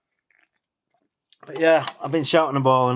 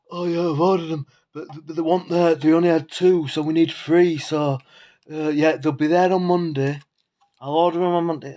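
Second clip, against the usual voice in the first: mock whiny voice of the neighbour, pitched higher; mocking imitation; 5 semitones higher, 4 dB louder than usual. Retelling the neighbour's excuse to his viewers in a higher mocking voice. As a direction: parrot her excuse back, higher and sneering.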